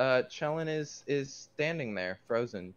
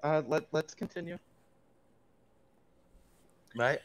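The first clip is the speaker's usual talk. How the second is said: ordinary talk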